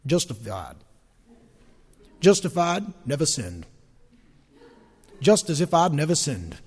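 a hint of a North Carolina preacher, spelling the word out; Billy Graham impression. Preacher quoting Billy Graham, light North Carolina accent. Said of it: "the way Billy Graham says it".

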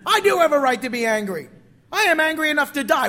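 loud, high, defiant insistence; indignant outburst. Acted. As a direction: fling it back at God in protest. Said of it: "Jonah can't help but say".

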